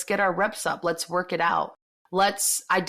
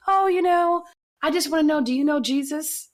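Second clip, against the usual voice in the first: put-on sweet young lady; 10 semitones higher, 4 dB louder than usual. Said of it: "she was just like".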